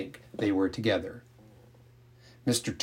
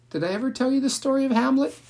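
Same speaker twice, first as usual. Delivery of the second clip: eager pitch-up; faster than usual. A narrator's reading. Eager storyteller launching a tale.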